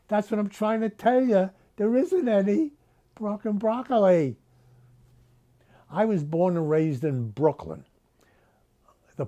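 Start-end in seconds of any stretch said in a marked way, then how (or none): 0.1-4.4 higher, exasperated yell of "There isn't any fucking broccoli"; exasperated outburst. Acted. Grocer Tony yells at Missus Russo, exasperated and higher. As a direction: blurt "fucking broccoli" up high, at the end of his rope.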